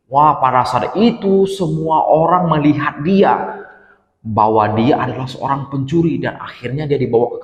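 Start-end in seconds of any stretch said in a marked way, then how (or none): none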